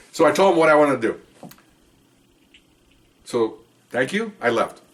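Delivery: ordinary talk, nothing marked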